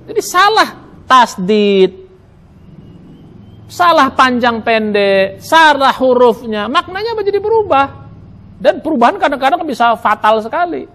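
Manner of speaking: ordinary talk, nothing marked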